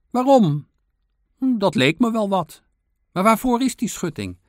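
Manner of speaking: high, loud squirrel voice; calling out. A narrator's reading. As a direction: call the words down from above. Squirrel shouting questions down to beaver.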